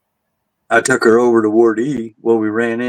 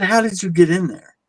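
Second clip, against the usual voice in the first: higher, pressing disbelief; incredulous question; 9 semitones higher than usual. Retold or imitated. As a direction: push him for an answer, baffled. Arguing with coworker about room.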